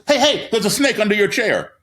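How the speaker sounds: high, loud startled warning; sudden alarm. Shown as a demonstration. Teacher to kids, a sudden fake snake alarm. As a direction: jolt the class with a fake alarm.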